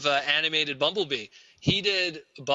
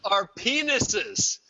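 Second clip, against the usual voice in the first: indignant rant; 6 semitones higher than usual. Retold or imitated. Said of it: "And I was just like".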